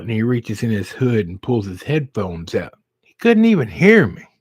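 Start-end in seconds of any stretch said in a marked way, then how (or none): 3.0-4.3 exasperated. Real, not acted. Exasperated narration.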